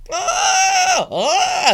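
high frantic penguin mama gasps; hammed-up frantic mother penguin; mock frantic gasps. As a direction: gasp in mock panic, high. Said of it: "mama walking over there all looking frantic".

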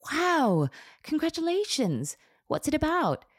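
high-pitched congratulations; impressed, excited. A narrator's reading. Hairstylist to client with a bestseller, impressed and excited.

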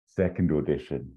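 ordinary talk

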